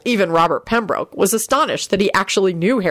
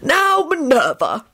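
put-on slow, raised-pitch raspy old portrait; raspy wheeze; 9 semitones higher, slower than usual. A narrator's reading. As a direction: wheeze it out slowly. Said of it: "wheezed the raspy voice".

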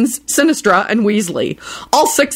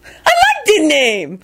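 delighted; 9 semitones higher, slower than usual. Acted. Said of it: "enthused delightedly".

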